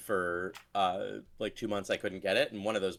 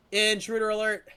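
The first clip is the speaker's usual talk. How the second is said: high, louder alarm, stress on "Intruder"; alarm shout; 10 semitones higher, 8 dB louder than usual. Pretend. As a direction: punch "Intruder", loud and high. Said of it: "that's my raid intro".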